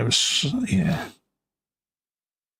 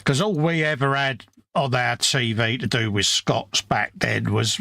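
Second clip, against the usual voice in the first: ordinary talk, nothing marked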